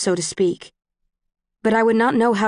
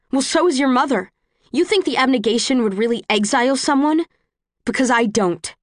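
raised, cutting jab; angry; 4 semitones higher than usual. Acted. Quarrel on the train.

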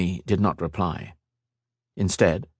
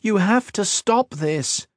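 pleading; 13 semitones higher, slower than usual. Acted. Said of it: "begged Kat".

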